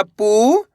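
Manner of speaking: पू held long, gliding up; stretched vowel. Shown as a demonstration. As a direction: stretch the ऊ in पू upward. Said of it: "तो खींच के पढ़ना है".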